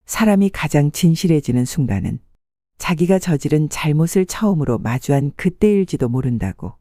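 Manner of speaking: plain reading voice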